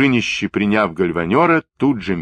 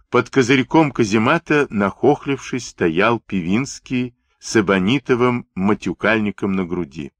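usual speaking voice

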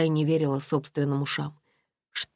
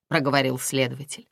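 plain reading voice

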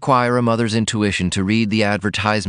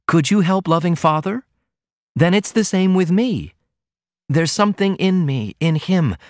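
faint triumph; 9 semitones higher than usual. A narrator's reading. Daughter defending her love to her mother, a touch of triumph. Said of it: "a certain faint triumph in her voice".

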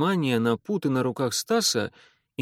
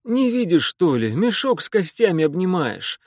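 breathless wheeze; 6 semitones higher, 4 dB louder than usual. Acted. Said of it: "прохрипел Стас".

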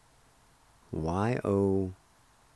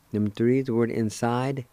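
ordinary delivery

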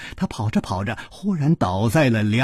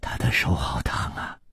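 usual speaking voice

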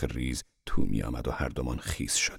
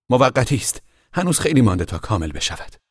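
hasty; 8 semitones higher, 4 dB louder than usual. A narrator's reading. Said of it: "با شتاب گفت".

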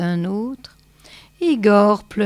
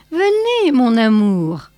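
put-on old crone; 3 semitones higher, 5 dB louder than usual. A narrator's reading. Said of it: "dit la vieille en agitant une main décharnée".

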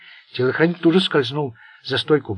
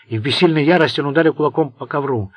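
ordinary delivery, nothing marked

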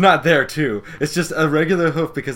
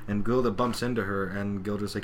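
ordinary talk, nothing marked

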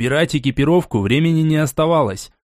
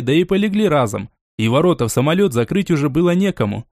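plain reading voice

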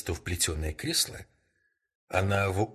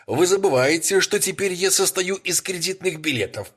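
high, loud, bright dismissal; excited laughing retort; 12 semitones higher, 7 dB louder than usual. Acted. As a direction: brush it off with a high, loud burst. Rex laughs off the warning.

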